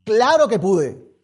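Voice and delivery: slow, loud, triumphant proclamation; triumphant shout. Real, not acted. Preacher proclaiming to the church, loud and triumphant. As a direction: ring it out in victory.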